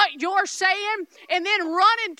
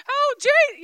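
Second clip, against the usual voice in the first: high, louder mock-frightened cry; panicked prayer; 10 semitones higher, 5 dB louder than usual. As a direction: imitate someone scared calling on Jesus. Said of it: "those are fear-filled prayers".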